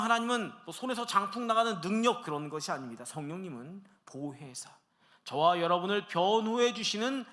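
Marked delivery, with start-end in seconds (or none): 3.0-4.7 whisper. Real, not acted. Pastor stresses Helper softly.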